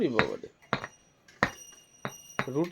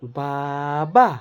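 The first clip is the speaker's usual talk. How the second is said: বাবা drawn right out, low; low, very slow বাবা, lifting at the end; slow word modelling; 9 semitones lower, much slower than usual. Shown as a demonstration. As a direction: stretch both syllables of বাবা very slowly for a baby. Models the word slowly for baby.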